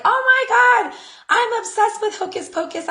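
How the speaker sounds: high, thrilled gush; gushing fan. Retold or imitated. Telling the friend how much she loves the movie, gushing high. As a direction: gush about a favourite film. Voicing her own gushing reply.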